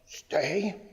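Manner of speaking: soft, questioning, rising command; mock tentative. Trainer mimicking owners who look too serious, tentative. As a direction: imitate an over-serious owner unsure of the command. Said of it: "we get so ... We look so serious".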